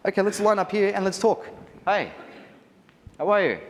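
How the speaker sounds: mock awkward posing chat. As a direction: play stiff guests faking a chat. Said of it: "be like".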